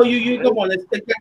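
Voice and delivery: ordinary talk, nothing marked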